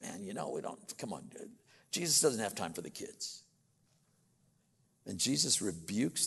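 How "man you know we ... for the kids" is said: low, quick, offhand grumble; dismissive disciples. Retold or imitated. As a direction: shoo them off under your breath, softly and fast. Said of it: "the disciples are going".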